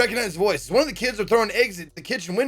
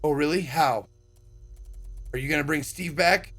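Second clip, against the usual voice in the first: usual speaking voice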